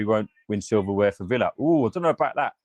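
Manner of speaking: ordinary talk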